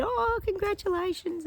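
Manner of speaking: high, slow and sweet, like cooing to new parents; sweet congratulations. Retold or imitated. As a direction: coo it gently up high. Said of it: "gave him a few hugs and said".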